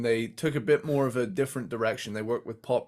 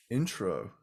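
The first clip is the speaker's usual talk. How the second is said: low, quiet deadpan; dead serious; 4 semitones lower, 7 dB quieter than usual. Retold or imitated. Host imagines the kid reading a cassette, deadpan. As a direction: say it in mock total seriousness, pitched down. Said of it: "dead serious, goes".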